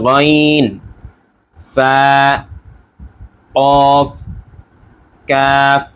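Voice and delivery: slow recital from গাইন to ইয়া; teacher reciting letter names; Arabic letter names. Shown as a demonstration. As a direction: read each Arabic letter with a clear pause. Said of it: "আমি যেভাবে উচ্চারণ করছি".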